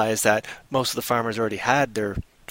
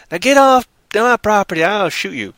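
higher, louder farmer chasing him off; threatening farmer; 6 semitones higher, 4 dB louder than usual. Acted. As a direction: order him off the land.

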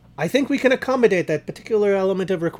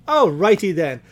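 ordinary delivery

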